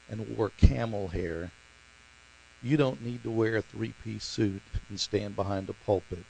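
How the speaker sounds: soft, measured delivery with long pauses; quiet divine answer. Retold or imitated. The Lord answering a young man's prayer, hushed and deliberate.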